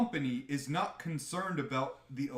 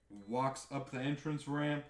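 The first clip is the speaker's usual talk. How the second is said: ordinary talk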